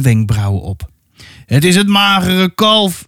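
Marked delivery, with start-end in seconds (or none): 1.5-3.1 calling out. A narrator's reading. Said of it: "riep hij naar de mannen".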